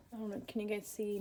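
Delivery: soft whisper. Real, not acted. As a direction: drop to a whisper. Quiet aside to viewers.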